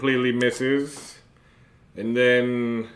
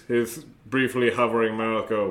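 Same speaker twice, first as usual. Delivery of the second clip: ordinary talk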